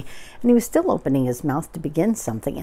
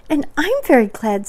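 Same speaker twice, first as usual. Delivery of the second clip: high, loud little voice; happy Piglet; 8 semitones higher, 6 dB louder than usual. Acted. Piglet chimes in after Pooh, high and happy. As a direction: pipe up eagerly, stressing your own gladness.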